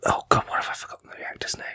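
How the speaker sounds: hushed frantic aside; whispering. Real, not acted. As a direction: whisper it to yourself in a panic.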